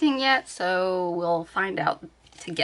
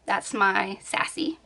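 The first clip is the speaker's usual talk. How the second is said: usual speaking voice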